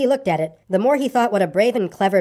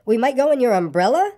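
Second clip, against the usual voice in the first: higher pitch, "umbrella" swung right up; bright-idea repeat with a big rise on "umbrella"; insistent rising repeat; 5 semitones higher than usual. A narrator's reading. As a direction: press the idea, lifting "umbrella" high. Pooh's line repeated, escalating each time.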